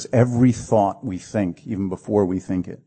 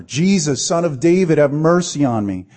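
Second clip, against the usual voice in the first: higher, louder plea for mercy; crying out louder; 6 semitones higher, 4 dB louder than usual. Retold or imitated. Blind Bartimaeus shouts past the crowd, louder plea. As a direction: cry it out all the louder. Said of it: "he cried out all the louder".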